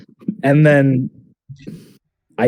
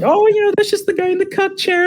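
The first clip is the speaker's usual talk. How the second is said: put-on high, squeaky girly voice; mock dainty girl voice; girlfriend voice. Telling him about the guy in the chair, squeaky girl voice. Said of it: "And then she's like".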